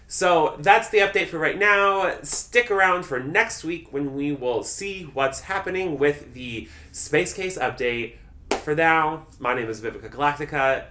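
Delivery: big host-style wrap-up; show sign-off. Real, not acted. Podcast host's sign-off.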